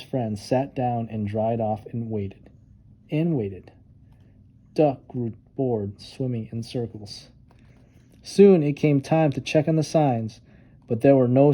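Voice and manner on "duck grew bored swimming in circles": ordinary delivery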